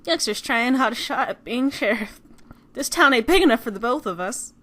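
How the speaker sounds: loud mock southern sheriff; bad southern accent. Player acting sheriff for McCree, bad southern accent. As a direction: play sheriff in a badly faked southern accent. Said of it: "speaking in a really bad southern accent".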